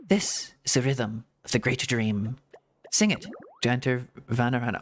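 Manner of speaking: lightly voiced game characters, read out; casual dialogue read-out. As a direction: read the quest lines as a light, lively read-along. Reads game dialogue aloud.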